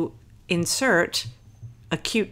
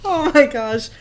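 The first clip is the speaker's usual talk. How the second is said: laughing; 8 semitones higher, 8 dB louder than usual. Real, not acted. Laughing at own ham joke.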